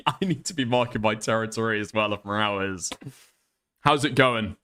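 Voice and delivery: usual speaking voice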